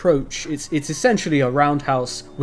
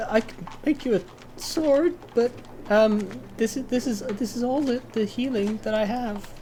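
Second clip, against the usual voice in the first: put-on much higher, softer girly voice; high girly half-orc, apologetic; 9 semitones higher, 4 dB quieter than usual. Half-orc blacksmith apologizes to the bard about her small stock. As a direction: apologize sweetly and shyly. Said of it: "And she says".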